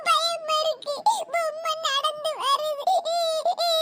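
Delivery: slow, drawn-out frightened wail; scared crying child. Acted. Small child sees the doll walking, scared wailing. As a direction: whimper the words in fear. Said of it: "பிள்ளைங்களா, பயப்படாதீங்க".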